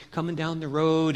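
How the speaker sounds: ordinary delivery, nothing marked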